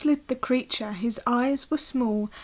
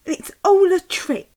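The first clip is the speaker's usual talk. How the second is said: put-on higher and louder child voice; indignant Gruffalo child; 7 semitones higher, 7 dB louder than usual. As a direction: call out the trick. Child protests.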